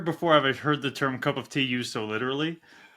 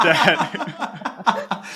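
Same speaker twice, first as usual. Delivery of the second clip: laughing; 4 semitones higher, 4 dB louder than usual. Real, not acted. Laughing after the pun joke.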